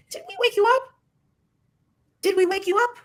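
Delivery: very high, rising mimicking voice; squeaky mock question. She mockingly asks Dre about waking, very high voice. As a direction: pitch it right up, teasing.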